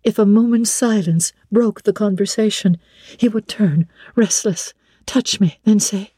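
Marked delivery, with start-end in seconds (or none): none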